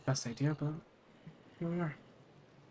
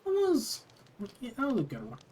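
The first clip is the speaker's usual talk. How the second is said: delighted; 8 semitones higher than usual. Real, not acted. Delighted reaction after story.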